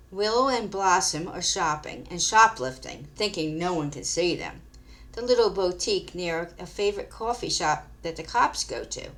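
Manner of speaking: plain reading voice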